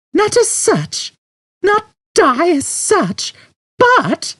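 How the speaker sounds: very high, slow, incredulous repeat; shocked echo. A narrator's reading. Trillian appalled by Zaphod, repeating his words. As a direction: throw his words back at him in disbelief.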